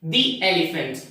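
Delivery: "The" like "dee" before "elephant"; "The" as thee. Shown as a demonstration. Teacher gives students the example, "The" as thee. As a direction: say "The" with an ee, running into "elephant". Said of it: "you have to pronounce this word as".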